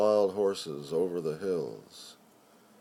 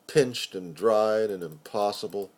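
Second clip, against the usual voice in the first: plain reading voice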